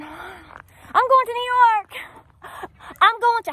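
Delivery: high, loud, slow put-on voice; imitated migrant. Vlogger mimicking migrants heading to New York, high put-on voice. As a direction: drawl it out high as the newcomer.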